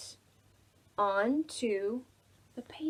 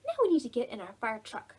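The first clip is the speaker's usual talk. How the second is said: normal talking voice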